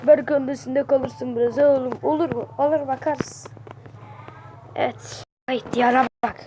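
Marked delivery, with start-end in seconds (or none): none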